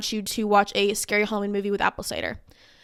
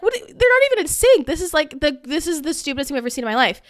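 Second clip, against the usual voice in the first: high-pitched mock outrage, "They're not even in sync" shrieked much higher; outraged disbelief; 4 semitones higher than usual. Telling viewers her reaction, indignant squeal. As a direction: scoff at the dance as the stupidest thing ever. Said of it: "I'm like".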